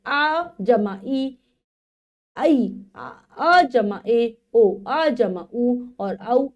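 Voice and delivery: very slow, each vowel sound drawn out for the class; slow classroom dictation of vowel sums. Shown as a demonstration. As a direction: spell it out letter by letter, slowly. Teacher spells out vowel combinations.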